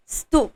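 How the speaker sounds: स्तु said short, half स joined to तु; stu. Shown as a demonstration. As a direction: blend half स into तु. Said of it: "स को आधा कर दो और तु". (स्तु)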